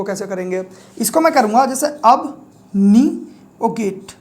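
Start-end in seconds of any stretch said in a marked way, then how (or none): 1.9-4.1 अब नी ओ गेट slowly, in separate chunks; split into words. Shown as a demonstration. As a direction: break it up as अब, नी, ओ गेट with pauses. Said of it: "इस तरीके से पढ़ूंगा".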